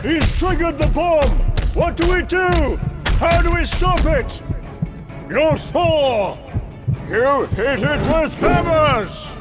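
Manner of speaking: high, urgent cries; panicked shouting. Acted. Gods facing a triggered bomb, alarmed high yelling. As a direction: call out frantically over the battle.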